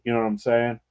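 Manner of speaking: ordinary talk, nothing marked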